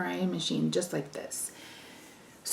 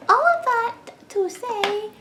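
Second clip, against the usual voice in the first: high, slow sing-song repeat; drawn-out playful restart, pitched high; mock dramatic; slower than usual. Shown as a demonstration. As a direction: stretch it out high and theatrical. Repeats phrase, second take stylized.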